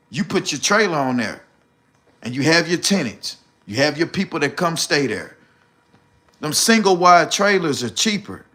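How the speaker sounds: usual speaking voice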